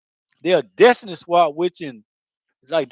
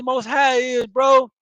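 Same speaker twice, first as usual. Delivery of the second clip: ordinary talk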